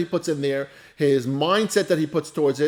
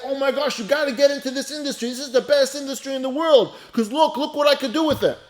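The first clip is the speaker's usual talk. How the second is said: high-pitched excited gushing; acted-out excitement, pitched up; 6 semitones higher than usual. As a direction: gush like an eager convert to a new business. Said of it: "He gets all excited that".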